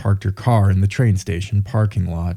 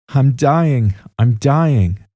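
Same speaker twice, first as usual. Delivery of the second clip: higher, restrained cry, falling each time; narrated outcry; 6 semitones higher, 4 dB louder, much slower than usual. A narrator's reading. Victim crying out as she is attacked, understated narrated cry. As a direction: read a dying cry with mild urgency. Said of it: "She cried out".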